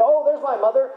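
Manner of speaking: a little bird's eager cry of hope; excited baby bird. Retold or imitated. Baby bird mistakes plane for mother.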